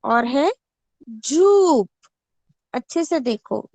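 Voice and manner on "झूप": झूप said loudly with the u drawn out; teacher models झूप for the class; clear model reading. Shown as a demonstration. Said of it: "ऊ का उच्चारण कर रही हूं मैं". (झूप को ज़ोर से, साफ़ ऊ के साथ)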